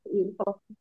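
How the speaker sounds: ordinary delivery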